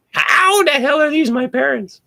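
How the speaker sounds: squeaky high, slowed mock outrage; incredulous disbelief. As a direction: ask it as if you can't believe your eyes. Meeting parents' family, disbelief.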